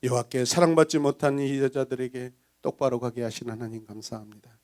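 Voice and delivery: normal talking voice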